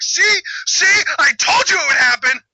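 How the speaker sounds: loud, high crowing; high-pitched mock triumph; mock I-told-you-so. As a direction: crow it loudly as if proven right. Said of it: "you're gonna be like".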